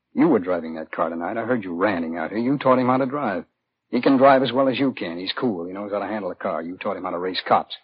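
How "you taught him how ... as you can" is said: higher and louder, throwing his own words back; mocking angry echo. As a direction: fling the father's boast back in his face. Doctor throws father's words back.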